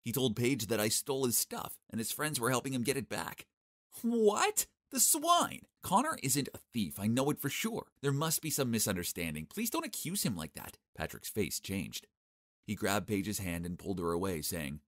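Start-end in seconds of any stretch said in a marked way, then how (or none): 4.0-10.8 indignant. Acted. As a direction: protest in outrage. Paige indignant defending Connor.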